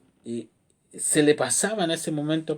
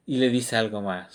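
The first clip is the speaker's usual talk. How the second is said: usual speaking voice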